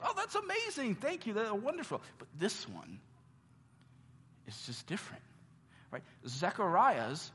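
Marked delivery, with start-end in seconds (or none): 0.0-2.0 high, thrilled mock gratitude; squeaky, beaming thanks; delighted Zechariah. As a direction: gush like an overjoyed old man.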